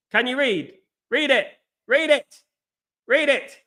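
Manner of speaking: high, insistent prodding; pointed challenge. Real, not acted. Challenges a doubter to read passport.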